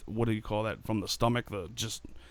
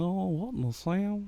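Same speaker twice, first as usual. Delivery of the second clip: drawl; 8 semitones higher, 8 dB quieter, slower than usual. Pretend. Joking reply bit.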